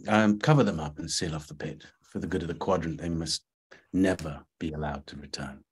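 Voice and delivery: low, soft cold command; cold quiet order. A narrator's reading. Cold order to seal pit.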